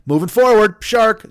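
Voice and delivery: high, loud, puffed-up shout; mock shark battle cry. Shark boasting to the other fish, a hammed-up loud shout. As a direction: bellow it like a proud shark swimming off.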